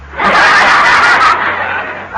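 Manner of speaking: big loud hearty laugh; loud breathy laughing burst. Real, not acted. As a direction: roar with laughter. Laughs at Liz's sarcasm.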